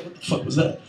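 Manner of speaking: whispered, jumpy question; paranoid whisper. Retold or imitated. Paranoid stoner hearing a noise, whispered. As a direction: whisper it spooked.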